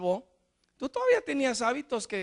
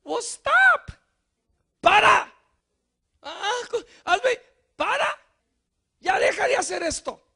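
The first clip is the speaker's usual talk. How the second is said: mock God saying stop; 8 semitones higher, 4 dB louder, slower than usual. God voiced telling to stop.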